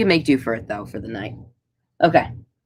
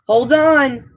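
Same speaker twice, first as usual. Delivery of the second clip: acted higher-pitched warning cry; urgent call-out; 8 semitones higher, 4 dB louder than usual. Poe warns the crew before a jump, urgent call-out. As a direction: call out as Poe bracing everyone.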